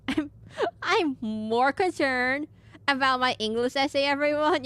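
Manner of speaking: mock-dramatic slow whine; drawn-out mock complaint. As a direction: drag out the complaint for laughs. Joke line drawn out to chat.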